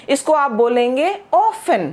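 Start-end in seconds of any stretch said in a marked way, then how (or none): 1.3-1.9 often said 'offen', with no T sound; silent T. Shown as a demonstration. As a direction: drop the T in often, saying 'off-en'. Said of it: "इसकी pronunciation में टी की आवाज नहीं है". (often में 'टी' की आवाज़ नहीं, 'ऑफ़न')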